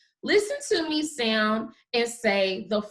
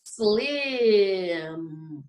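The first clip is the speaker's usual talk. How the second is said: slim drawn long and lower; say it slowly; 4 semitones lower than usual. Shown as a demonstration. Teacher saying it one more time, slowly stretched. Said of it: "gonna say it one more time".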